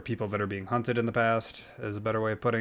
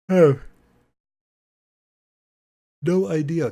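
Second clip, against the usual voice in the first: loud exasperation; 7 semitones higher, 8 dB louder than usual. Real, not acted. Exasperated outburst at trailer.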